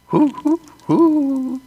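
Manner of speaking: high hooting owl call. Pretend. Showing off his owl call on the porch, high hoots. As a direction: hoot up high like a little owl. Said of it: "Aren't I good?".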